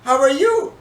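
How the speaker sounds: name hushed, then a high, loud question; mother's voice. Retold or imitated. Mother questioning the younger brother, high probing voice. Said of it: "she said".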